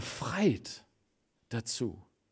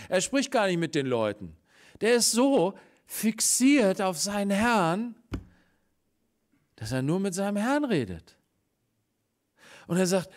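normal talking voice

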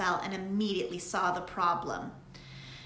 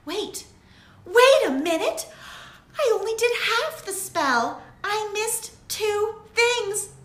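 much higher and louder, thrilled; excited realization; 12 semitones higher, 10 dB louder than usual. A narrator's reading. Lily realizing she missed two things, excited. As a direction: discover the answer with joy.

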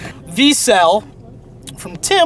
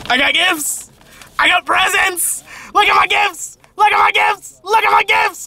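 excited; 11 semitones higher than usual. Real, not acted. Shows off birthday presents.

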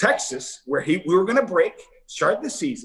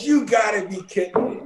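higher, mock shout of relief; stunned disbelief; 4 semitones higher than usual. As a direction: blurt it out once the prank is revealed. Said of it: "And he goes".

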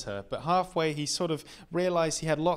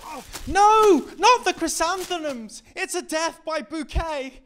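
much higher put-on wail; mock damsel cry; 7 dB louder than usual. Boyfriend being beaten with chrysanthemums, hammed-up high wail. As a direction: ham up a fainting victim. Girlfriend beats him with flowers.

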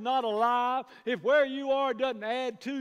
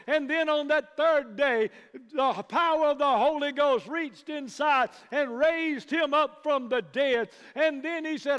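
louder, building sermon delivery; preaching at full force; 2 semitones higher, 5 dB louder than usual. Real, not acted. Preacher exhorting congregation, loud rising preaching. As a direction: drive it home to the congregation.

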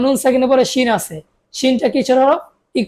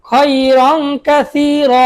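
drawn-out syllables for pupils; slow recital; 3 semitones higher, much slower than usual. Shown as a demonstration. Teacher slowly models খয়রন Kathir for pupils. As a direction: stretch খয়রন Kathir very slowly, vowels held. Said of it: "আপনি আমার সাথে বলুন".

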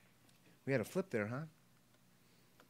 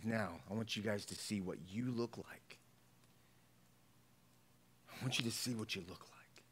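hushed whisper, much softer; whispered confrontation; 6 semitones lower, 9 dB quieter than usual. Acted. Jesus showing the Pharisees themselves, whispered. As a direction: whisper it as a revelation.

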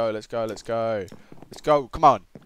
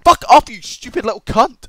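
high, loud abusive outburst; angry telling-off; 9 semitones higher, 7 dB louder than usual. Retold or imitated. Gamer telling off a show-off rival, angry shout. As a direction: lay into the rival loudly.

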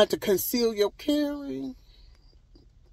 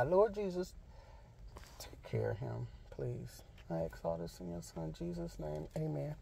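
quiet prayer; 14 dB quieter than usual. Real, not acted. Prays for sick man.

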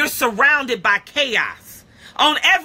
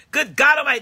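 normal talking voice

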